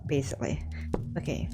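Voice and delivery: soft whispered aside. Real, not acted. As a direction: half-whisper under the breath. Aside muttered.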